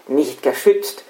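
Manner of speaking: ordinary talk, nothing marked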